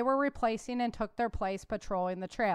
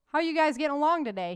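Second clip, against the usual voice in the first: quick, higher, louder Midwestern greeting; Midwestern accent; 6 semitones higher, 6 dB louder, faster than usual. A narrator's reading. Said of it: "in a Midwestern accent".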